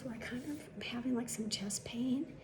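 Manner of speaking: very soft, halting admission; hesitant patient. Retold or imitated. Man tells the staff his symptoms, hesitant and hushed. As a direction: imitate a patient confessing symptoms under the breath.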